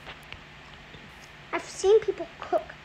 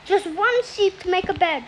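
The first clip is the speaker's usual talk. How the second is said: ordinary talk, nothing marked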